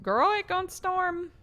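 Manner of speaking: high, slow sassy warning; sassy girl voice. Pretend. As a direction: warn your girlfriend with high, slow sass. Sassy warning about storm.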